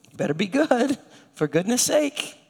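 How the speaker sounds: sing-song hint of a stern threat; mock stern warning. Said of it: "we say, maybe in our own heads".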